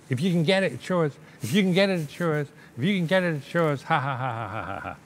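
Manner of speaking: high, teasing sing-song with a cackle; taunting singsong. Retold or imitated. Uncle teasing the little boy with money, high mocking singsong. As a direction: dangle the bait and laugh at the kid.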